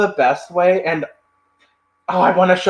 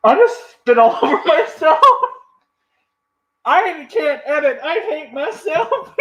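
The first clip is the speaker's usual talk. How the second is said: laughing; 13 semitones higher than usual. Real, not acted. Embarrassed laughing after spitting drink.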